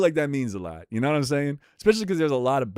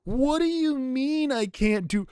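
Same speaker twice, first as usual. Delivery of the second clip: whiny player; slower than usual. Retold or imitated. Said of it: "Motherfuckers will be like".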